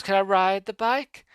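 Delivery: high, slow, teasing question; imitating a taunting bully; mock-polite request. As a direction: ask with fake sweetness, drawn out. Biker mockingly asks little clown.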